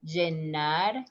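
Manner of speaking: llenar as "jenar", buzzed onset; voiced j pronunciation. Shown as a demonstration. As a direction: start llenar on a zh sound. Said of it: "How you pronounce?".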